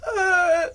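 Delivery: high, loud, drawn-out uh; mock freeze-up wail; imitated panicked groan. Vlogger showing camera nerves, imitated wail. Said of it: "I was like".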